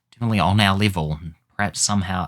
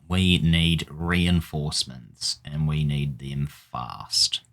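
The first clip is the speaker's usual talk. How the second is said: ordinary talk, nothing marked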